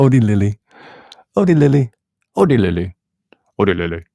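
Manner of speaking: lightly put-on Indian accent on Odi Lily; mock Indian accent. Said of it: "That's how they, they'd say it in India".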